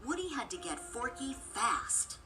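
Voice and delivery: ordinary delivery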